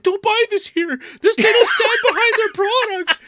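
hammed-up screaming customer. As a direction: shriek a warning to everyone. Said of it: "Just screaming".

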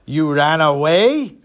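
slow, incredulous big-man voice; drawn-out put-on giant's question; huge gruff man. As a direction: repeat it slowly as a looming giant. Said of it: "three hundred pound, seven-foot man".

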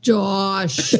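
drawn-out greeting on the name; dad answering phone. Shown as a demonstration. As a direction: imitate a dad picking up. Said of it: "do my dad answering the phone".

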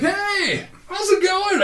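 put-on high bright pitch, breezy and casual, like greeting an old pal; casual buddy greeting. Greeting a famous actor at the airport, breezy high buddy tone. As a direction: act like he's a good buddy, high and easy. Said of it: "I just act like he's a good buddy".

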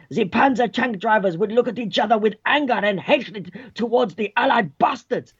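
hammed-up German war narration; theatrical German-accented history teacher; mock German accent. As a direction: declaim it in a cartoon German accent. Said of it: "He's doing a German accent".

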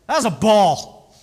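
loud, slow jab at the umpire; heckling the umpire from the coaching box; chirping at the umpire. Retold or imitated. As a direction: call it out like a coach disputing a pitch. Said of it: "I'm chirping".

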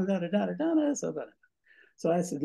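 ordinary talk

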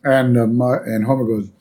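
usual speaking voice

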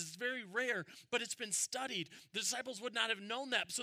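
fervent pulpit voice; full-force preaching. Acted. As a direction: drive it home to the congregation. Preaching about the anguish.

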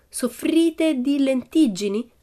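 very slow, high "soffrite di lentiggini"; reading aloud. A narrator's reading. Annika reading for Pippi, slow high "soffrite di lentiggini". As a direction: spell out "soffrite di lentiggini" word by word. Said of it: "lesse Annika".

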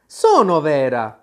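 loud, slow, insistent protest; upset insistence. A narrator's reading. Alice insists that she is real, upset protest. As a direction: assert it firmly and slowly. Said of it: "affermò Alice e si mise a piangere".

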